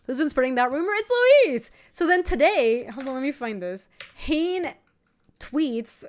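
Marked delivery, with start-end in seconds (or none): none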